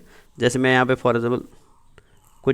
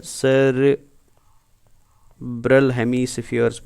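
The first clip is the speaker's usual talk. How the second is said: drawn-out key term; much slower than usual. Shown as a demonstration. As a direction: stretch the term syllable by syllable. Teacher stretching out the key term.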